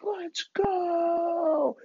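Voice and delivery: high, drawn-out crowd-hyping cry; hyped cheer. Retold or imitated. Kid cheering on his brothers, high hyped cheer. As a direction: hype up a crowd. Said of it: "cheering on the crowd, hyping 'em up".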